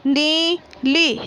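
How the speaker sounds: नीली drawn out very slowly, syllable by syllable. Shown as a demonstration. Teacher reading aloud to pupils, नीली stretched very slowly. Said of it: "बोलकर पढ़िए".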